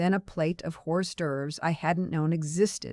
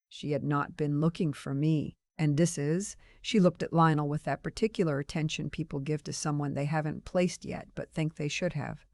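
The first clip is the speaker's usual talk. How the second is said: ordinary delivery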